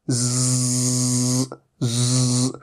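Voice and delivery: ज़ as a long voiced buzz, teeth together; buzzing z sound. Shown as a demonstration. Teacher demonstrating the buzzing ज़ sound to students. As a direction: hold a buzzing ज़ through the teeth. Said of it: "ये कुछ ऐसी आवाज है".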